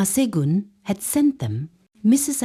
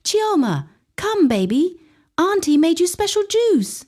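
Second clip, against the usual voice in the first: sweet auntie voice; 11 semitones higher than usual. A narrator's reading. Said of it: "hands shook as she called out".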